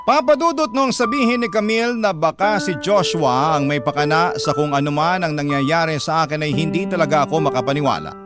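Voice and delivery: usual speaking voice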